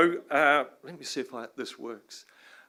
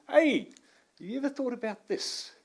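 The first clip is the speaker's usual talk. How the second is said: chummy question; 12 semitones higher than usual. Pretend. Voicing a casual question to audience.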